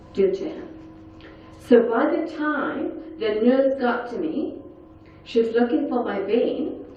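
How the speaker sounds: ordinary talk, nothing marked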